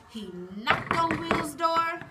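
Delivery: ordinary delivery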